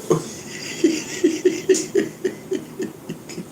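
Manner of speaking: real high-pitched breathy giggle. Real, not acted. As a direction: burst into a high, airy laugh. Laughs at own joke.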